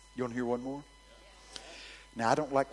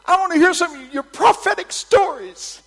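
high, shouted imitation of eager people; mimicked loud demand; 15 dB louder than usual. People asking the preacher for stories, mimicked loud demand. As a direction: call it out loudly as the crowd would.

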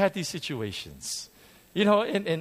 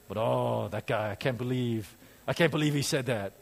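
lower, grumbling inner voice of an offended member; mock resentful muttering; 5 semitones lower, 3 dB quieter than usual. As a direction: grumble it to yourself, half laughing. Voicing resentful churchgoer's thoughts.

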